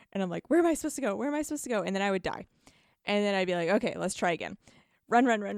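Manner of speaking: ordinary talk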